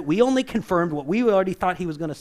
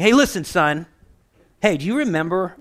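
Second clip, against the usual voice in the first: mock defensive scold; 7 dB louder than usual. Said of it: "He's not freaking out saying".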